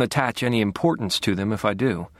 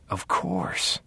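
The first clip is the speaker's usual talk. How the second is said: whispered; 6 dB quieter than usual. Acted. Sudden realization, breathed.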